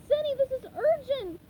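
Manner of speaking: high, loud and pressing; urgent plea. A narrator's reading. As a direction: insist it can't wait. Urgent SpongeBob.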